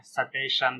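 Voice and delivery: स्टेशन with an extra vowel, "suh-TAY-shan"; wrong full S. Shown as a demonstration. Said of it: "स्टेशन भी नहीं पढ़ना है".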